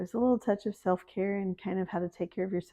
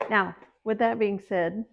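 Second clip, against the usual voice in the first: normal talking voice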